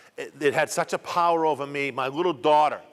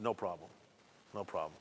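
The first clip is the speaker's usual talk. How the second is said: much softer and lower, almost under the breath; meek reply; 8 semitones lower, 15 dB quieter than usual. Retold or imitated. Husband quickly agreeing to his wife's warning, meek and hushed. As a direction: quietly give in, eager to agree.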